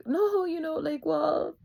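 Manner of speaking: high-pitched, tearful protest; on cry mode. Retold or imitated. Daughter answering worried parents, on cry mode. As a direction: falter upward, close to tears. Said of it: "I just was already on cry mode".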